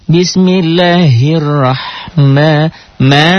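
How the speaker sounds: slow chanted "bismillahirrahma"; slow recitation. Shown as a demonstration. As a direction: draw out each syllable. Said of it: "Nah, yang kedua".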